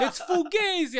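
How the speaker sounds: high, slow, loud drawn-out yell; loud sharp voice. Retold or imitated. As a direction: stretch it out, yelling. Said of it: "the way he was yelling was amazing".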